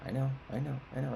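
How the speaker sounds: mock placating voice; imitating dad conceding. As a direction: mimic a dad who weakly agrees. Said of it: "he's like".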